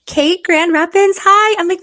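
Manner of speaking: squealing hello to a fan; excited greeting. Real, not acted. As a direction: call out the name with delight.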